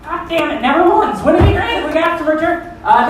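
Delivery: ordinary delivery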